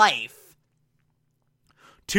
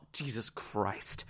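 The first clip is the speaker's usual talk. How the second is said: muttered groan; 8 semitones lower, 18 dB quieter, slower than usual. Real, not acted. Groaning at the writers' mistake.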